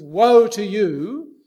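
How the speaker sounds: loud, slow, drawn-out condemnation; booming woe. Retold or imitated. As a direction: thunder it like a fire-and-brimstone preacher. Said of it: "speaker like me turns up and says".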